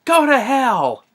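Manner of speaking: high, drawn mock yell; retold yell. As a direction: imitate a defiant yell without full volume. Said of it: "he yells".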